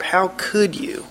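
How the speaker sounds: slow, high-pitched COULD falling away; acted heartbroken reproach. Wife confronting husband about their relationship, acted reproach. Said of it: "a wife that's heartbroken over an unfaithful husband".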